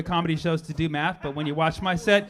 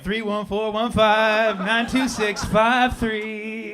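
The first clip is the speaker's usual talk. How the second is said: slow recital; 4 semitones higher, much slower than usual. Shown as a demonstration. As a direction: proclaim every digit slowly. Reciting pi digits grandly.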